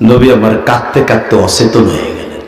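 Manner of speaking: low, subdued narration; hushed sorrow. A narrator's reading. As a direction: drop down in grief after the outcry. Sorrowful drop after the screamed plea.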